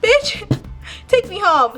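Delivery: retold outburst. Angry demand to be taken home.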